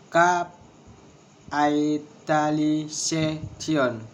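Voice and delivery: "Capitalization" very slowly, broken into syllables. Shown as a demonstration. Teacher showing a word of six syllables, syllable by syllable. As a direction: stretch it out, one syllable at a time. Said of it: "six syllables".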